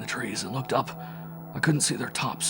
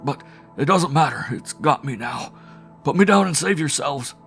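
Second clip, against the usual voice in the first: desperate plea; 6 semitones higher, 7 dB louder than usual. A narrator's reading. Wells despairing plea.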